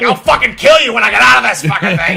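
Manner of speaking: fast furious shouting doll; angry doll shout. Pretend. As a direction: scream threats like a trapped doll. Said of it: "it was like".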